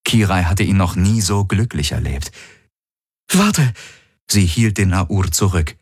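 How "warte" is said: urgent call. Acted. Kirai stops Hawks.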